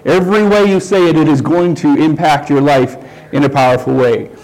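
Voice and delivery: ordinary delivery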